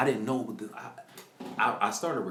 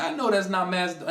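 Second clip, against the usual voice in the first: astonished; 8 semitones higher, 6 dB louder than usual. Retold or imitated. Repeating his astonished words.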